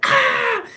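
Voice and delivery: piercing high loud scream; startled scream. Retold or imitated. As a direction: scream as the dogs charge. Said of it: "I really screamed".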